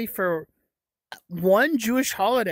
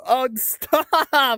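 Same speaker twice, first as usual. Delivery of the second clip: helpless laugh breaking into the words; giggling plea, laugh taking over at the end; 4 semitones higher, 3 dB louder than usual. Real, not acted. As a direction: protest through real laughter. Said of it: "it just makes me laugh".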